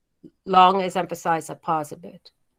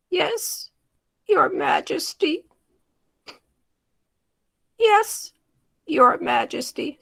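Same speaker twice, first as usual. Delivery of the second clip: slow and drawn out; 8 semitones higher, slower than usual. Shown as a demonstration. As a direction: stretch it like a humble servant. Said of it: "we'll do the opposite now".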